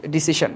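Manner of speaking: "ডিসিশন" as di-si-shon, with sh; Bengali-style -shon in "ডিসিশন"; common pronunciation. Shown as a demonstration. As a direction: say "ডিসিশন" the everyday sh way. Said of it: "আমরা বলি".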